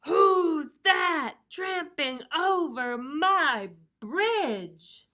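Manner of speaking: very slow, loud, high roar; huge drawn-out troll challenge. A narrator's reading. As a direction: bellow each word as a troll. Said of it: "roared the troll".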